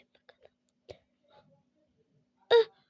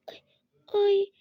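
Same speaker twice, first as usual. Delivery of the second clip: usual speaking voice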